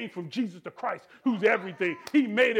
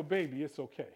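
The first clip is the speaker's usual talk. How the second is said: tender comfort; 6 semitones lower, 7 dB quieter than usual. Retold or imitated. Preacher voicing comforting words.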